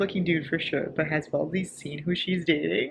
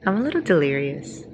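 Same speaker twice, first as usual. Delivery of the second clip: ordinary talk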